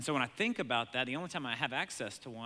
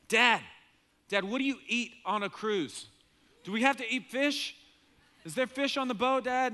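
put-on high, louder pestering child voice; eager kid; 9 semitones higher, 7 dB louder than usual. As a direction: tug at Dad with eager questions. Kids asking dad about cruise food.

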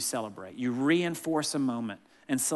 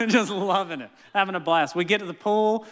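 ordinary talk, nothing marked

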